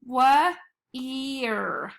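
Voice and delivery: "wa-ear" split into wuh, then ear; sounded out in parts. Shown as a demonstration. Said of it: "So it should be".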